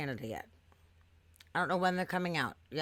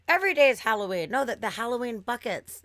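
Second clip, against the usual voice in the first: higher and louder, insisting; exasperated insistence; 7 semitones higher, 9 dB louder than usual. Retold or imitated. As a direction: hammer it home to the clueless worker. Arguing with the confused worker.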